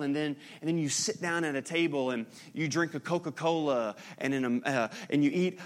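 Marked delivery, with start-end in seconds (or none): none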